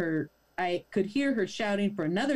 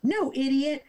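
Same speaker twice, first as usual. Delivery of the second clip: higher, condescending correction; mock Karen scorn, a bit higher; impatient snap; 6 semitones higher than usual. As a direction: talk down to him. Karen snapping at narrator.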